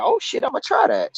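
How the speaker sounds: high, startled "oh shit" of a sudden idea; jumping at the tip, a little amazed; surprised. Retold or imitated. Retelling hearing a YouTuber's tip, pitched high in surprise. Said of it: "and I was like".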